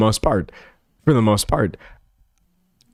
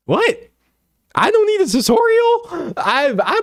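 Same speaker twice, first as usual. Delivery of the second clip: mock indignant. Mock-indignant reply to manosphere advice.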